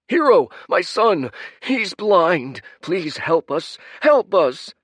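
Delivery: high, loud begging cry; desperate plea. Acted. Parent begging the hero for help, high and desperate. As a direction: beg the hero to save your son.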